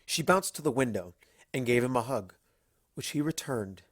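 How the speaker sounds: plain reading voice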